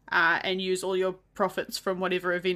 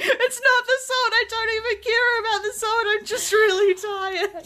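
whiny tired wail; 13 semitones higher, 5 dB louder than usual. Retold or imitated. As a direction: wail it like an overtired toddler. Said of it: "it's like".

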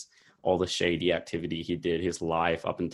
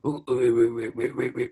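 bad Italian-accent grunts, higher; Tom Hardy grunting; 5 semitones higher than usual. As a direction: imitate mumbled mobster grunting. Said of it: "went around grunting in, like, the worst Italian accent".

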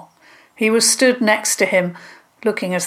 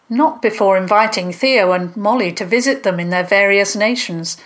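usual speaking voice

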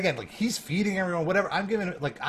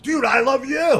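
higher and louder, shouting it back; retold shout; 10 semitones higher, 8 dB louder than usual. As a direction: yell the line back with excited energy. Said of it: "I gave it back to him".

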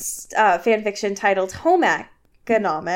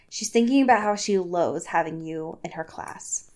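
low, understated annoyance; annoyed; 6 semitones lower, 6 dB quieter than usual. Acted. Edward answers Bella's quizzical look, annoyed. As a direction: mutter it with a touch of irritation. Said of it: "in an annoyed tone".

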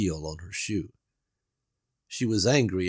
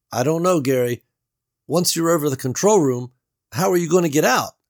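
higher, louder doubtful Beverly; Beverly's voice; 7 semitones higher, 7 dB louder than usual. A narrator's reading. Beverly doubts Gary's escape plan, worried and raised. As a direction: voice her worry, raised.

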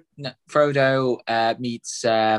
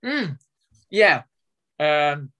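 eating an apple; 3 semitones higher, much slower than usual. Real, not acted. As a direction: mouth full of apple. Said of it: "I'm eating an apple".

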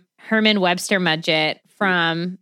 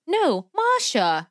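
put-on high, slow child correcting in Russian accent; higher, drawn-out Russian-accented "MAH-sha", no R; little girl's put-on accent; 6 semitones higher, slower than usual.

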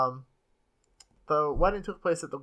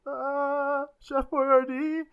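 nervous answer; 11 semitones higher, much slower than usual. Pretend. Nervous answer under interrogation.

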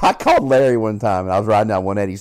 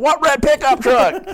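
slow, much higher, louder alarm; imitating a friend startled by the prank; 4 dB louder, slower than usual. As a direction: panic at the news. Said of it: "He's like".

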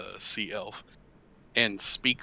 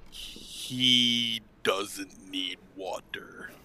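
very slow, whispered shark voice; awful common tongue; 6 dB quieter, much slower than usual. Acted. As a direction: labour through each word in a whisper. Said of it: "your common tongue is awful".

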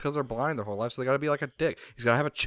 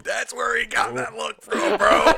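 slow, high laughing shout; excited shout; 7 semitones higher, slower than usual. Real, not acted. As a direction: belt it out in disbelief. Shouting amazed at Stallone's mom.

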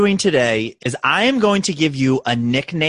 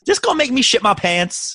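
crude blunt version; 11 semitones higher than usual. Retold or imitated.

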